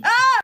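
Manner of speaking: short loud, high yelp; acted scream. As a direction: let out a sudden mock scream. Scream during the chase story.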